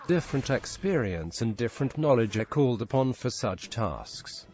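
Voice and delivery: ordinary delivery, nothing marked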